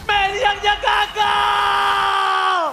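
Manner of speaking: high, loud hype shouting; fighting game announcer. Acted. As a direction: scream the kills like an arena announcer. Caster shouting through teamfight.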